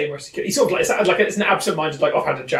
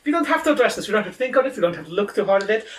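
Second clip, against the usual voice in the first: lightly higher, gentle coaxing; reassuring Leonard; 8 semitones higher, faster than usual. Acted. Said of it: "So it's like".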